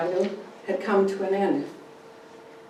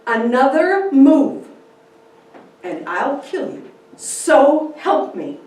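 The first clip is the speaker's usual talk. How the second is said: slow, loud, deadly threat; fierce warning; 7 semitones higher, 12 dB louder, slower than usual. Acted. Kitty aiming the shotgun at the drunk, slow fierce warning. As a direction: make every word a promise. She points shotgun at him.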